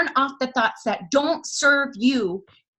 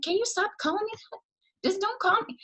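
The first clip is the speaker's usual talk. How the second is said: high, softer retold plea to stop; higher and quieter, imitating your own embarrassed protest; mock pleading protest; 8 semitones higher, 5 dB quieter, faster than usual. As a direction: beg them off in a small, high voice. Retells protesting at being called a title.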